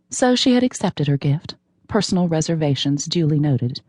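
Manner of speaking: ordinary delivery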